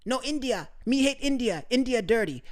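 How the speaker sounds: mock the girlfriend's broken English; imitating broken English. Imitating his ex-girlfriend's broken English about India. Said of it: "every time she would see a group of Indian people".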